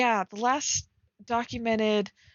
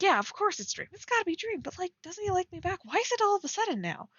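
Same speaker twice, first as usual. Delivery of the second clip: anxious realization; 8 semitones higher, faster than usual. Shown as a demonstration.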